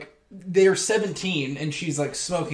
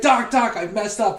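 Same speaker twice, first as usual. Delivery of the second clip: panicked confession; 6 semitones higher, 4 dB louder than usual. Retold or imitated. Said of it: "he's like".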